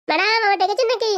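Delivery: a lightly voiced young villager. Padu arriving asks madam if she called.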